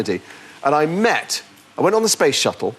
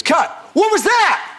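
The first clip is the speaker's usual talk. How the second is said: imitating a shouting director; slower than usual. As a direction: bark it across the set like an angry director. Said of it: "he's a shouter".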